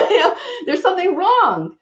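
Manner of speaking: laughing through the words, pitch jumping high. Real, not acted. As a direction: laugh it out at yourself. Laughs at her own failing body.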